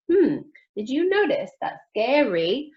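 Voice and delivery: normal talking voice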